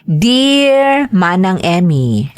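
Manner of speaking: ordinary talk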